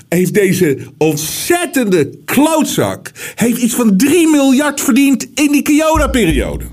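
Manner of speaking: indignant outburst, pitch climbing high; real outrage, stretching the insult out. Real, not acted. Host railing at investor who made billions in corona, indignant.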